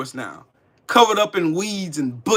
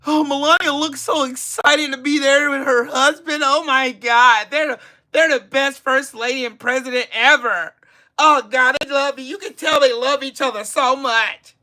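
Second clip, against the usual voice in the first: sarcastic gushing; 5 semitones higher than usual. Acted. Sarcastic praise of Trump couple.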